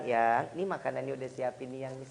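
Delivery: low, soft acted coaxing; sweet-talking wife. Wife announcing lunch is ready, sweet and low. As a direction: play a doting wife, voice dropped low and soft.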